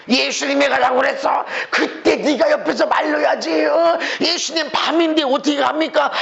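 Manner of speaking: hammed-up complaining; mock blaming. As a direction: act out an indignant quarrel, scolding a companion. Acts out disciples blaming each other.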